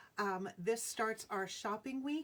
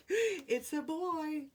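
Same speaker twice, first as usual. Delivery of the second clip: excited announcement; 7 semitones higher, 5 dB louder, much slower than usual. Real, not acted. Repeating gender reveal result.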